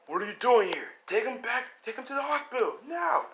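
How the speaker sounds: hammed-up hero shouting orders; mock yell. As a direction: bark the order like a frantic hero. All Might angrily ordering them back.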